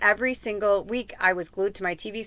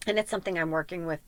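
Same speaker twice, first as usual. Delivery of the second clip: ordinary delivery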